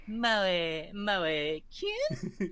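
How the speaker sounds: lightly imitated maid chant; a hint of maid cafe cuteness; cutesy maid voice. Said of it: "when they go".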